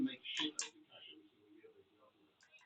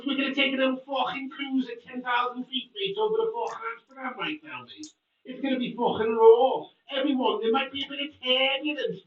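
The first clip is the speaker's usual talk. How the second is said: mock Scouse pilot; 7 semitones higher than usual. Mocking a Scouser pilot to his stream, in mock Scouse. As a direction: lay on a thick mock Scouse accent as a cabin announcement. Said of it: "Imagine if you get on a plane and it's like".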